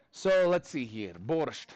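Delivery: put-on Russian accent. Teacher warming up for class, mock Russian accent. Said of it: "do this in a Russian accent".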